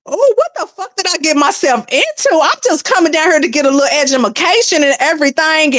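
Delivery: high-pitched, exasperated self-questioning; hammed-up what-have-I-done lament; retold dismay. Acts out her dismayed reaction.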